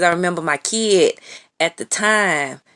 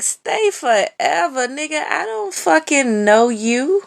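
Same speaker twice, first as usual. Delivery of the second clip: appalled disbelief; 9 semitones higher than usual. Retold or imitated. Throwing his creepy invite back at him, high and incredulous. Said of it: "I was like".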